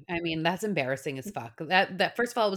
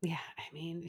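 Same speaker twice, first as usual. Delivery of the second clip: whisper; 14 dB quieter than usual. Real, not acted. Whispered aside.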